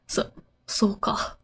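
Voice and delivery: very soft, whispery stammer; hesitant stutter. Acted. Torako reacting to being told it's fine, stuttering softly. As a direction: stammer it under your breath. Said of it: "so here she's stuttering again".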